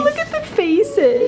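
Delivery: high, slow cooing at dogs; talking to a dog. Real, not acted. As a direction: coo at the dogs' faces.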